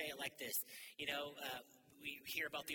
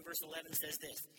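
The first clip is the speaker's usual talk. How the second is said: ordinary talk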